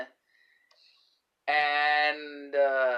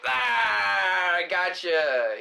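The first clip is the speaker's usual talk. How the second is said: mock pounce shout; 4 semitones higher, 5 dB louder, much slower than usual. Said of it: "Not just jump out like".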